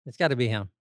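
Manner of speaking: normal talking voice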